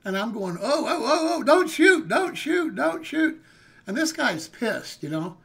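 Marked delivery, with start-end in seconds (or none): 0.6-3.4 high, loud frantic begging; panicked pleading not to be shot. Retold or imitated. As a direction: beg for your life, voice up high. Begging man with gun.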